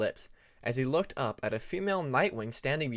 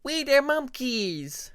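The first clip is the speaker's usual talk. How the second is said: put-on high, slow, sing-song little kid voice; a tiny dragonet chanting with glee; excited child cheer; 12 semitones higher, 6 dB louder, much slower than usual. A narrator's reading. Little son cheering to his father, high and slow. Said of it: "as his son said this".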